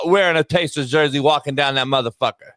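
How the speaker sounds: normal talking voice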